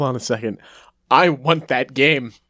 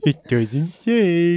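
mocking imitation; much slower than usual. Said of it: "Using my own line against me".

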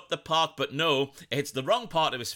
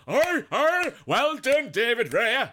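much higher, louder barking Yorkshire "Oi, oi"; seal-like Yorkshire cheer; 9 semitones higher, 5 dB louder, slower than usual. Retold or imitated. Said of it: "doing his best impersonation of a seal".

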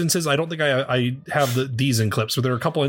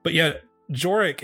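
normal talking voice

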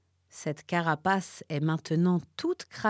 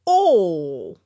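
a short, loud, hungry Oh; warthog's exclamation; 11 dB louder than usual. A narrator's reading. Warthog licks his lips, loud gleeful Oh. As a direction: relish the food with a loud Oh. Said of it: "s'exclama-t-il en se léchant les babines".

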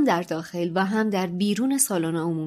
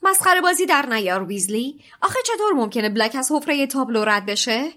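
surprised; 5 semitones higher, 4 dB louder than usual. A narrator's reading. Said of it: "با تعجب".

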